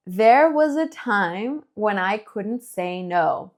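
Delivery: slow, even read-aloud pace; semi-slow read-along. Shown as a demonstration. Teacher reading along with students at a slow pace. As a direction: read slowly so learners follow. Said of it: "at a semi-slow pace".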